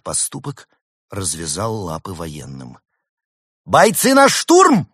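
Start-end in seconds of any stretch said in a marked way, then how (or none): 3.7-4.9 high, loud, stretched command; military order. A narrator's reading. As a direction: shout the troops into attack. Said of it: "приказала майор".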